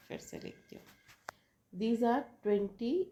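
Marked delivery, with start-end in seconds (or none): 0.1-0.8 barely voiced, very soft aside; muttered aside. Real, not acted. Teacher to herself as she rewrites, muttered under the breath. Aside to self while writing.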